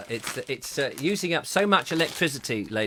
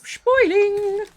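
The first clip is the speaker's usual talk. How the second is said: squeaky high voice; 7 dB louder than usual. Acted. Echoing the compliment on the presents.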